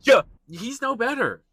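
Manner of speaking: high, loud scoffing outburst; riled up. Real, not acted. Scoffing at Fred Astaire's hypocrisy, riled up. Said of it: "this has got me r-, riled up".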